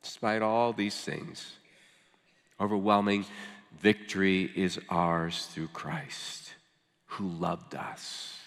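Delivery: slow right down, dropping softly to the last words; slow, careful reading. Real, not acted. Preacher reads of victory through Christ, slow and hushed. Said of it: "read it kind of slowly and carefully".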